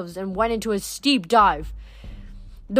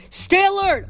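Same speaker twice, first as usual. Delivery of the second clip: high-pitched called-out command; pitched well up, calling out; urgent order; 10 semitones higher than usual. A narrator's reading. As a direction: call a warning to your mount. Calling orders to horse mid-flight.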